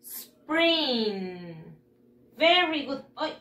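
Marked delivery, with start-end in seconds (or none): 0.0-1.5 SPRING drawn out, falling at the end; model pronunciation. Shown as a demonstration. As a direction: say "Spring" slowly for pupils to copy. (pronunciación modelo, alargada)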